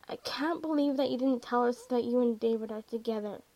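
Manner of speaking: plain reading voice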